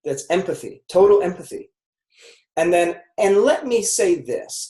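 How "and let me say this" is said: gentle firmness. Shown as a demonstration. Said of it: "like the parent".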